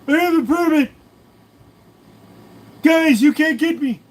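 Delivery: very high, loud squeaky cartoon voice; high shrieking cartoon impression. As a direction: squawk it like a cartoon character. Cartoon voice bit.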